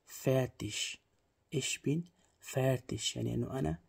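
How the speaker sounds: ordinary talk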